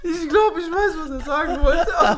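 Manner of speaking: high giggly voice through laughter; words squeezed out between laughs; laughing. Real, not acted.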